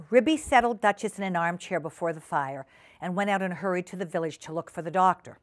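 ordinary delivery

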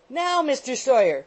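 high, loud landlady's summons; shrill scream. A narrator's reading. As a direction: screech for the lodger's attention. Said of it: "screamed the shrill voice of Missus Rattle".

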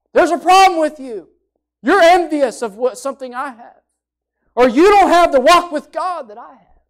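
indignant accusing voice; imagined rebuke. Pretend. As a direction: hurl the accusation at a rival. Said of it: "things she could have said".

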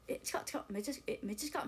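小声でひそひそ驚く; 近さにこっそり驚くささやき; 小声のまね. Retold or imitated. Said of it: "小声で。". (ひそひそ声で)

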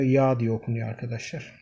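normal talking voice

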